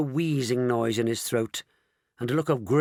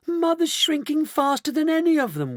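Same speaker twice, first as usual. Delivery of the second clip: very high panicked wail; 4 dB louder than usual. A narrator's reading. Daughter watching her mother shrink, wailing. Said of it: "wailed Missus Bucket".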